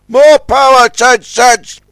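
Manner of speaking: loud, high urgent cry. A narrator's reading. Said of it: "cried Scuttlebutt".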